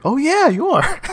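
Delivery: high-pitched mock-surprised reply; mock realization. Director answering the actor on set, mock realization. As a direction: play a sudden, high "oh yeah".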